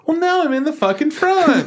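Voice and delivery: very high-pitched mocking complaint; mock whiner. Moviegoer griping about a front-row seat, mock whining.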